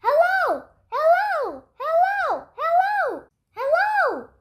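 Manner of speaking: high, loud ringing repeats; echo yelling back. Acted. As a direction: echo it off the gym walls. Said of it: "The gym yelled back".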